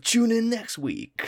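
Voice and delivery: slow, high TV announcer voice; old-school anime narrator; cartoon announcer. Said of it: "when it was like".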